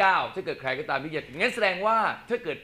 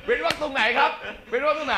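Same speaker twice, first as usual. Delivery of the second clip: higher, laughing through the question; 9 semitones higher than usual. Real, not acted. Host laughing back at the band member about the space, higher laughing question. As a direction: giggle while asking where the space goes.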